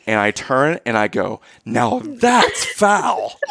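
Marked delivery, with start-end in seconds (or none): 1.6-3.4 high, slow, drawn-out jeer; taunting yell. Retold or imitated. Taunting the family after kicking the ball away, high, slow jeer. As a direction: taunt the whole family, stretching it out slowly. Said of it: "I yell it".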